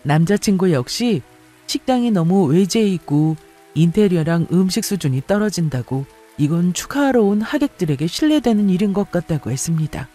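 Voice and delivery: ordinary delivery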